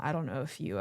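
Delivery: ordinary talk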